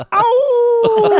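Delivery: high howling owww; wolf howl. Acted. Wolfman howls on entrance.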